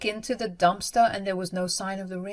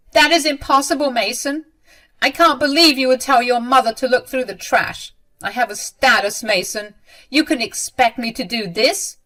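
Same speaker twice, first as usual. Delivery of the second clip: loud, higher-pitched indignation; affronted protest; 6 semitones higher, 7 dB louder than usual. A narrator's reading. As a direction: object as an insulted mother. Mother protests the demand.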